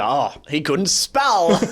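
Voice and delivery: slow deadpan. Retold or imitated. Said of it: "And Messenger".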